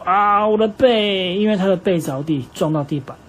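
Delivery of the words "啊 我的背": slow, high, loud pained cry. Acted. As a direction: wail it as if his back just hit the floor. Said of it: "老虎先生看起来很痛苦". (痛得大叫，拖长的高声)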